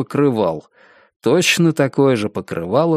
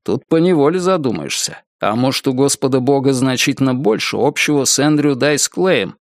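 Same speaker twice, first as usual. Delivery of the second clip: ordinary delivery, nothing marked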